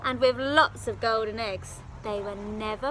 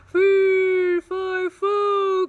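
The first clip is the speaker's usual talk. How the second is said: roaring giant; 8 semitones higher, 13 dB louder, much slower than usual. Acted. Giant falling.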